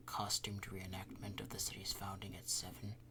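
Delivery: reading quietly. Acted. Councilman reading the schedule to himself, whispered. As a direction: murmur it to yourself. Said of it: "he reads quietly".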